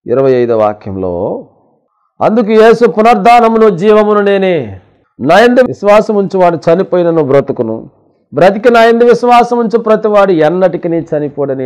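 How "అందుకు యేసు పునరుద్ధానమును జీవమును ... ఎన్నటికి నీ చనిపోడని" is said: raised, forceful preaching voice; scripture proclamation. Acted. Preacher quoting Jesus on resurrection and life, forceful proclamation. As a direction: declaim the verse to the congregation.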